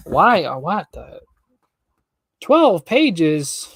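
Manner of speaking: loud, high disbelief; dismayed. Real, not acted. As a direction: react in shock at the length. Reader dismayed at chapter length.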